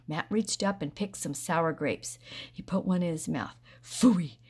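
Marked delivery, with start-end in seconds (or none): 3.8-4.4 loud disgusted phooey; spitting phooey. A narrator's reading. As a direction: spit the word out in disgust. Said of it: "he spit it out".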